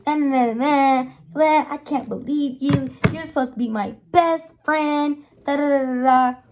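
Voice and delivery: mocking a friend's whiny accusation; imitating Emily. Mimicking Emily accusing her friend of siding against her. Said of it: "It's Emily".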